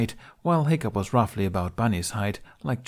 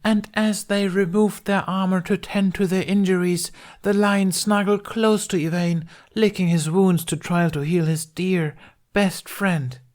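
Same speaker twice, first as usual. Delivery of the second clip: higher, louder storytelling voice; dramatic bard telling; 10 semitones higher, 5 dB louder than usual. Acted. Bard finishing the show for the audience, grand storytelling. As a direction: spin the tale grandly for the crowd. Bard ending the staged tale.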